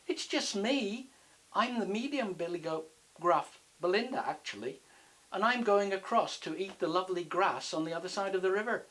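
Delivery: high, innocent goat voice; medium goat character. Acted. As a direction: play a harmless goat explaining herself. Voices Belinda, the middle goat.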